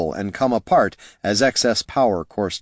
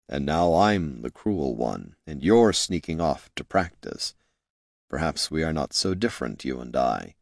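quietly admitting his own fault; rueful confession; 5 semitones lower, 5 dB quieter than usual. A narrator's reading. Jin confides regret.

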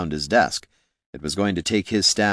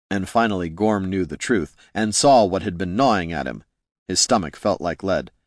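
plain reading voice

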